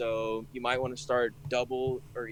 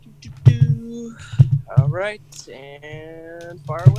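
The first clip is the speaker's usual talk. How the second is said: hype voice; 6 semitones higher, 14 dB louder, slower than usual. Pretend. Hyping up before reading codes.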